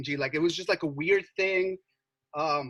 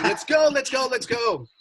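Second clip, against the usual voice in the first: higher, louder urgent shout; screaming; 8 semitones higher, 5 dB louder than usual. Retold or imitated. Girls yell at the boys to flee, loud urgent shout. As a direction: yell to hurry everyone into the car. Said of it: "they're, like, screaming".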